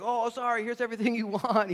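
high, loud, hurried-to-please parent; panicked appeasing. Acted. Parent giving in to a winding-up toddler, panicked appeasing. Parent caving to tantrum, acted.

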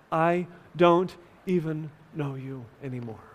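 subdued, solemn retelling of angry words; retold shout, hushed. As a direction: voice the shout quietly, as grieving love. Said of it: "as he shouts".

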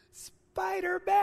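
put-on high, drawn-out little kid's blurt; nervous little boy. Father imitating his nervous son naming the hero, high and slow. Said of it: "all nervous".

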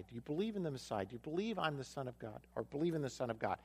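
soft, low, unhurried voice; gentle Jesus. Acted. Jesus gently asking the healed man about faith. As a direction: speak tenderly to the outcast.

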